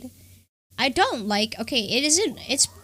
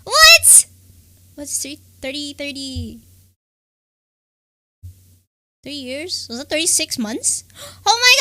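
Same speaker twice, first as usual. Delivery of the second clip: surprise turning into a high, loud squeal; dawning joy; 6 semitones higher, 10 dB louder than usual. Real, not acted. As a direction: let the realisation build into delight. Realising a subscriber is near three years.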